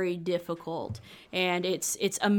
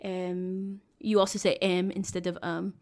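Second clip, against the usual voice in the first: throaty French accent with leaned-on Z's. Shown as a demonstration. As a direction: demonstrate French English, back in the throat. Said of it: "a little bit back in the throat".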